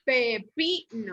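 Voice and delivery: Pepino slowly split as pe, PI, no; syllable by syllable. Shown as a demonstration. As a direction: draw out Pepino so PI stands out. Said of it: "lleva el acento en la pi".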